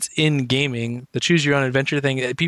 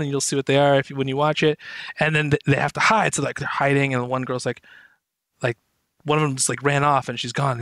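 normal talking voice

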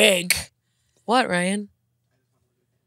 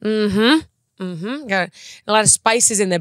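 ordinary delivery

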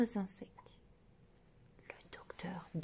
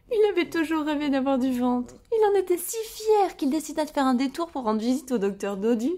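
proud; 5 semitones higher, 8 dB louder than usual. A narrator's reading. Proud narration of the belly.

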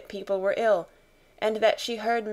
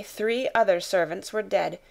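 ordinary delivery

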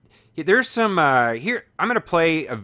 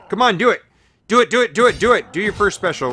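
much higher and louder, chanting "do it" at the screen; excited shouting; 9 semitones higher, 7 dB louder, faster than usual. Real, not acted. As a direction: egg the enemy on at full voice. Urging the enemy to attack.

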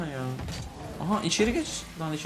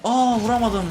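loud, higher cry of dismay; frustrated outcry; 7 semitones higher, 7 dB louder than usual. Real, not acted. Player missing his shot, loud frustrated outcry.

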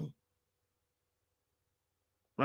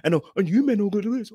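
loud, high mock scolding; pushy put-on tirade; disrespectful rah-rah; 7 semitones higher, 5 dB louder than usual. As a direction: imitate an accusing rant at someone's face. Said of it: "When you start doing that, you start disrespecting".

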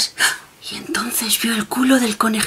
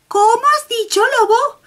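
much higher, bright little bunny voice; little rabbit voice; 3 dB louder than usual. Acted. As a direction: play the small rabbit, high and innocent. Rabbit answers the wolf.

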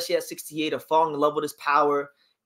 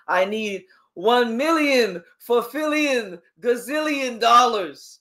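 slow, higher-pitched villain's ransom demand; Doctor Evil impression; 7 semitones higher, much slower than usual. Host mocking a boxer's money demand as Doctor Evil. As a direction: draw out each absurd figure in a high mock-villain voice. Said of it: "He sounds like Doctor Evil".

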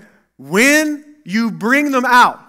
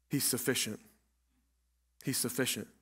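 hushed; 10 semitones lower, 21 dB quieter than usual. Real, not acted. Hushed closing point.

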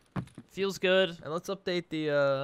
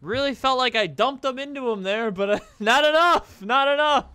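laughing; 8 semitones higher, 6 dB louder than usual. Real, not acted. Laughing at own death.

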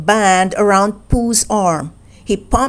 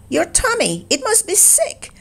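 much higher and softer fretting Piglet; tiny anxious piglet worrying; hushed high squeak of concern; 8 semitones higher, 4 dB quieter than usual. A narrator's reading. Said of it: "said Piglet".

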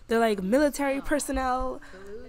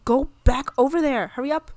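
loud, raised-pitch command; urgent order; 5 semitones higher, 10 dB louder than usual. Real, not acted. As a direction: send them back in a hurry. Ordering her Sim back.

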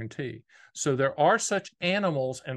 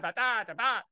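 politician cadence; 6 semitones higher than usual. Retold or imitated. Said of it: "talk like this and have to intonate every card".

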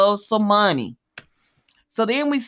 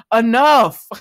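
loud, firm mock command to stop; Sonny; 3 semitones higher, 8 dB louder than usual. Imitating him stopping Christina going at Alexis, loud mock command. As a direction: imitate a man cutting off an argument loudly. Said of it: "y'all know how Sonny do".

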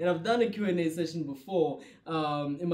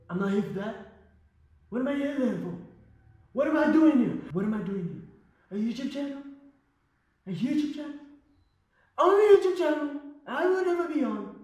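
Trump impression; 6 semitones higher than usual. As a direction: boast like Trump at a rally. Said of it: "Lefa Trump".

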